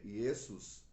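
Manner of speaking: Iesus said slowly as ee-EH-soos, Latin style. Shown as a demonstration. Teacher to listeners, slow Latin Iesus. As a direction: spell out Iesus slowly with an ee-eh start. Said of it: "do latim".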